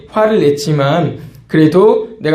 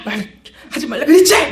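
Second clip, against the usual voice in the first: mock yell, pitched way up; scolding parent; 10 semitones higher, faster than usual. Parent to a child doing something risky, mock scolding shout. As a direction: act out an alarmed mother telling off a child. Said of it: "엄마들 어때요? 부모님들".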